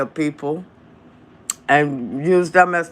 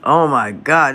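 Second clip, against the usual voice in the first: usual speaking voice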